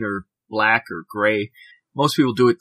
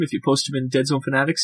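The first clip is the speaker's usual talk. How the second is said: normal talking voice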